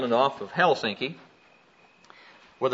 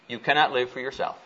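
ordinary talk, nothing marked

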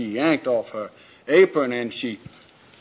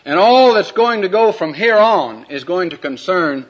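preaching; 4 semitones higher, 6 dB louder than usual. Acted. Preacher addressing the congregation about Jesus Christ, in emphatic preaching. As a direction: proclaim each word to the congregation.

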